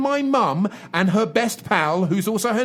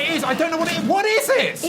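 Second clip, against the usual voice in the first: panicked shout; 8 semitones higher, faster than usual. Acted. Monster appears, panicked.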